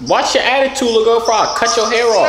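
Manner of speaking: high, loud telling-off; mock scolding. Telling off a cheeky girl online, high mock scolding.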